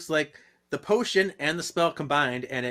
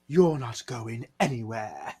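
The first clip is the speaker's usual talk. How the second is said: slow threatening demon; 2 semitones lower, slower than usual. Retold or imitated. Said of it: "it's Jarl again".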